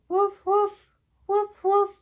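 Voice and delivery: high, loud playful woof; barking dog. Acted. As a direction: bark it out like a puppy. Imitating the dog for a toddler.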